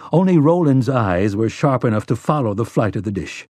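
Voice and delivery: ordinary delivery, nothing marked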